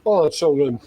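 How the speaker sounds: savoring, talking while chewing; smacking lips over food; mouth full. Real, not acted. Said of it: "Y'all get to hear me smacking my lips".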